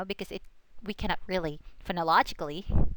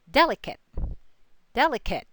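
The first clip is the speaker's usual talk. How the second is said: "Delicate" with DEL higher and stronger; stress on first syllable; 3 semitones higher, 4 dB louder, faster than usual. Shown as a demonstration. Teacher shows where the accent goes, "Delicate" stressed three from the end. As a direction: punch DEL in "Delicate", let the rest drop. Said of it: "accent usually falls on the third syllable".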